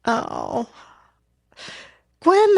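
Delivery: ordinary talk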